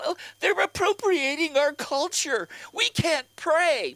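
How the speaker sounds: high, drawn-out whiny complaint; mock whining. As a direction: stretch it out like a big whiner. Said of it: "They're just big fucking whiners".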